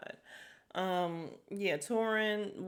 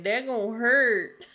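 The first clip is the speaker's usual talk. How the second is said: imitating Honora; 4 semitones higher, 10 dB louder, slower than usual. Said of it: "Honora said".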